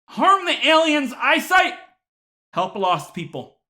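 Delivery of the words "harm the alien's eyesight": mock outrage. Real, not acted.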